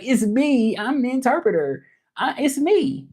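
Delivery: high-pitched, exasperated-sounding insistence; insistent protest. Retold or imitated. Interpreter telling confused callers it's really them, insistent. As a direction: act out insisting on who you are.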